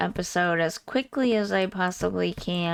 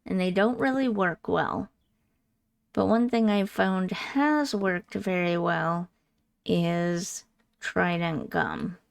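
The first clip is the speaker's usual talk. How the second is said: usual speaking voice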